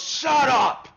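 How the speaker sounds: loud, high snap back; shouted shut up. Acted. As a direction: bark it at the kids. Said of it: "And he goes".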